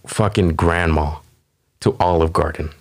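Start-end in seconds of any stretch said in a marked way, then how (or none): none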